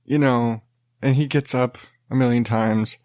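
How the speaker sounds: usual speaking voice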